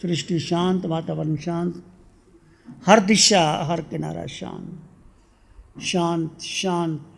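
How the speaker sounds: measured meditation voice with long pauses, softening as it goes; guided meditation. Real, not acted. Teacher leading seated devotees into inner silence, guided meditation. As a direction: guide listeners inward, phrase by phrase, letting endings fade.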